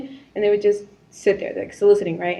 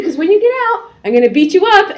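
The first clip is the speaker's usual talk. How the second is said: threat through laughter; 7 semitones higher, 6 dB louder than usual. Retold or imitated.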